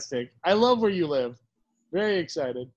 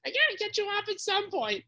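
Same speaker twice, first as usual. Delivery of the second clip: pitched way higher, softer imitation of Rob; 10 semitones higher, 4 dB quieter than usual. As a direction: raise the voice high to play his cohost. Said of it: "this is the voice".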